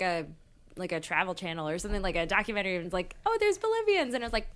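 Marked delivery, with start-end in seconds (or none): 3.3-4.1 high mock voice. Mimicking documentary narrator.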